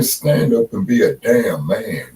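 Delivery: ordinary delivery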